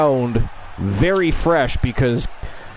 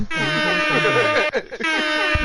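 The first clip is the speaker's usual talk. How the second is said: high shrieking laugh; 12 semitones higher, 3 dB quieter than usual. Real, not acted. Laughs at chat comment.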